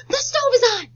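gasp, then a high loud alarmed cry; child warning an adult, mock alarm. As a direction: warn of danger in a hammed-up panic. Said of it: "disrupt an adult for a good thing".

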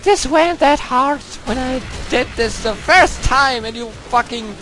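put-on a falsetto girl persona ranting in English at the game. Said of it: "I'm playing the English girl right now".

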